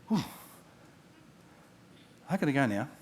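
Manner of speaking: usual speaking voice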